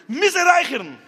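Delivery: high, loud outburst; urgent craving. Retold or imitated. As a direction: cry out the smoker's compulsion. Acting smoker's urgent craving. (א הויכער, דרינגענדער אויסגעשריי)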